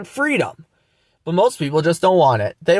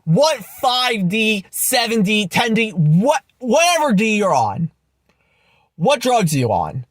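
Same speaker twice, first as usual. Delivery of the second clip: ordinary talk, nothing marked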